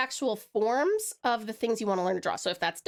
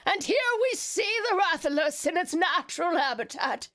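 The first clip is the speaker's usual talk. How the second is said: David Attenborough; 5 semitones higher than usual. Pretend. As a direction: narrate like a wildlife film. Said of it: "Yeah, that's not too bad".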